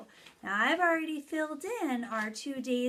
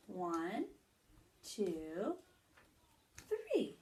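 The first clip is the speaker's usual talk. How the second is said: slow counting aloud; 3 semitones lower, 4 dB quieter, much slower than usual. Shown as a demonstration. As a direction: count slowly and pop up on three. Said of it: "I'll make three raindrops".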